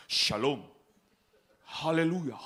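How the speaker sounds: mock churchy shalom; mock pious greeting. Mocking overly religious greeting.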